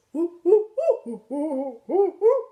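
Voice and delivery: loud, high monkey hooting; playful imitated monkey calls; monkey hoots. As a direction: hoot mockingly like a troop of monkeys. Monkeys copy the son.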